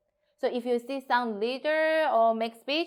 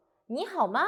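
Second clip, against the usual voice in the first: high, sing-song 你好吗 that ends going up; rising question intonation; 4 semitones higher, 3 dB louder than usual. Shown as a demonstration. Teacher showing go-up intonation on 你好吗, like a song. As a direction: ask 你好吗 with a climbing end, like singing. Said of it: "Totally go up".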